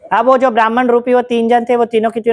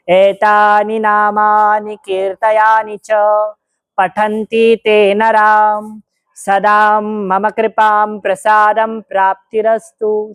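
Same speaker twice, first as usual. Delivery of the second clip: very slow, distant, echoing chant; voice from the samadhi; much slower than usual. Acted. Shripad's voice from the samadhi blessing the devotees, distant slow chant. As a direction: intone it like a voice rising from a tomb. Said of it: "समाधि स्थल से आवाज आया".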